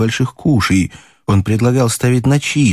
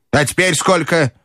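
brusque grandmother; 8 semitones higher than usual. A narrator's reading. Grandmother asks.

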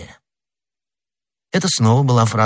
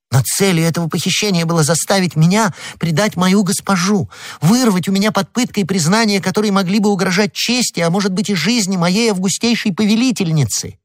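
high-pitched, breathless outrage; wronged wife protesting hotly; indignant accusation; 11 semitones higher than usual. Acted. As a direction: press the charge in a high, urgent voice. Indignant wife accuses her husband.

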